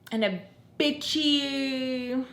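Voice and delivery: drawn-out whiny sneer; whiny bitchy. Shown as a demonstration. Mocking Hennessy's complaining, slow whiny drawl. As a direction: stretch it out like a spoiled whiner. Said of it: "wah, wah, wah, me tone".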